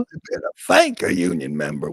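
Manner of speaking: lightly mimicked Kamala Harris union-rally accent; mock Kamala accent. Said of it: "what is that accent".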